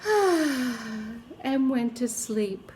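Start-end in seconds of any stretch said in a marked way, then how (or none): 0.0-1.2 put-on contented baby sigh. A narrator's reading. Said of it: "The baby said".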